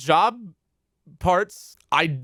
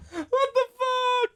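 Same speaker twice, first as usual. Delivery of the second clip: squealed through laughter; high laughing squeal. Real, not acted. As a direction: shriek it mid-laugh.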